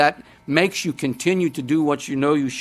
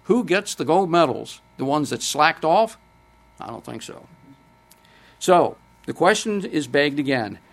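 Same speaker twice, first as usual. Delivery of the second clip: ordinary talk, nothing marked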